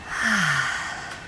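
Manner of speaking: soft low "ah" sigh; demo sigh. Shown as a demonstration. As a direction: sigh it out slowly and quietly. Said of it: "Let's do it again".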